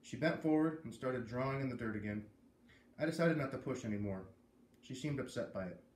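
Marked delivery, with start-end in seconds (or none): none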